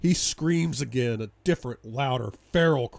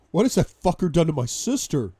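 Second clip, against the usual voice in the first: loud, raised, outraged question; alarmed fury; 6 semitones higher, 9 dB louder than usual. Acted. Brother fearing for his sister, furious alarm. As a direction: shout it in fear and rage.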